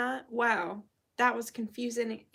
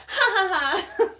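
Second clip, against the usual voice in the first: high loud mock cackle; witch cackle; 10 semitones higher, 9 dB louder than usual. Witch laugh after proclamation.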